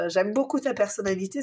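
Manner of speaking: ordinary talk, nothing marked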